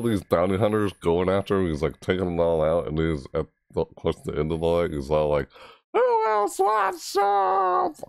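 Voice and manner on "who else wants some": very high, drawn-out falsetto battle cry; mock yelling. Gamer quoting an episode's fighter, high mock yell. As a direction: imitate a warrior's challenge, stretching every word slowly. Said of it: "Like, yelling".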